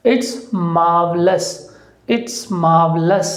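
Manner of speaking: "marvelous" with no r sound, said slowly; silent r. Shown as a demonstration. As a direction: drop the r in "marvelous". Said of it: "आर है वो silent रहेगा".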